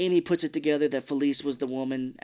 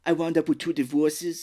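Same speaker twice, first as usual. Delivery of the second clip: louder, higher mock Austrian accent; Arnold Schwarzenegger; 4 semitones higher, 5 dB louder than usual. Co-host replaying the aunt's line, mock Austrian accent. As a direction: lean into a comic Austrian accent. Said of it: "that's how I'm gonna do that".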